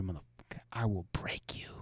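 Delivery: low threatening whisper; menacing barbarian whisper. Pretend. Acted threat to Goliath.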